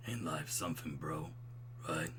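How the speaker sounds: low, soft, philosophical bro; smooth and buttery. Acted. Old roommate musing on fatherhood, smooth low voice. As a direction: let it roll out deep and easy. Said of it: "I can do smooth and buttery".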